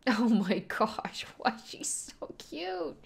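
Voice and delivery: slow, whispered delight; whispered coo. Real, not acted. Viewer whispers over how cute Anya is. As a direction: coo it under your breath.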